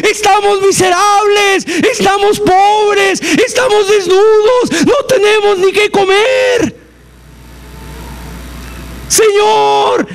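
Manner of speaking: high, wailing plea with a cry in the voice; desperate clamor. Pretend. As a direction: beg God as if starving and ruined. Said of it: "en cada casa se escuchaba el clamor". (súplica desesperada y llorosa del pueblo empobrecido)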